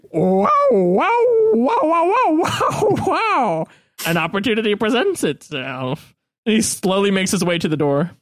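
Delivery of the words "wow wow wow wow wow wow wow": put-on high, rising old-man wows, louder; a delighted old coot gasping wow after wow; old man's wows. Old man mock-marvels at guesses.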